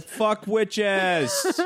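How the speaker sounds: high-pitched mock rant; mock cursed rant. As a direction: shriek it like a cursed fool.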